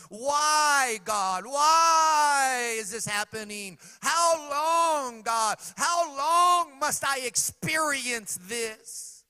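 loud, high, slow lament; anguished cry. Retold or imitated. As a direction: wail the questions heavenward. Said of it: "He cries these two questions out".

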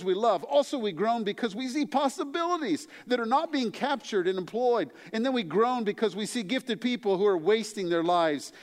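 high, emphatic pulpit voice; impassioned sermon reading; preaching. Acted. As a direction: preach the list of groans with rising fervor. Preaching Stedman quote, building.

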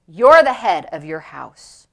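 low, slow, firm pronouncement; deep, emphatic divine verdict; the Lord's voice. Retold or imitated. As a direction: state it with slow authority. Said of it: "And the Lord said".

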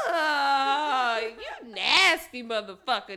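loud, grossed-out insult; disgusted. Retold or imitated. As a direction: spit it out in disgust. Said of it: "I was like".

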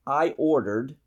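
normal talking voice

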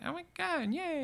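a tiny hammed-up yay; mock cheer. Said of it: "She's like".

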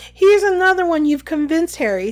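excited, high and loud start; excited character voice; Hermione. A narrator's reading. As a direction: announce good news, high then settling. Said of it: "said Hermione excitedly".

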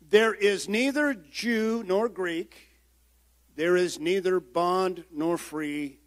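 slow, measured reading of the verse; solemn King James reading; scripture reading. Acted. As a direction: read it out deliberately, word by word. Reads Galatians verse aloud.

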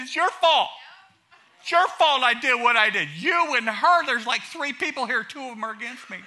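high, loud, whining self-pity with laughter; whiny victim. Retold or imitated. Pastor mocking a self-pitying man's whining to the congregation. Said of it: "He said".

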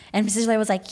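ordinary talk, nothing marked

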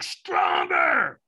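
loud, high, rough scream; imitated trainer's full-on scream; screamed command. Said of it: "he screamed at me".

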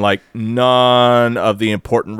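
usual speaking voice

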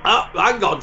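usual speaking voice

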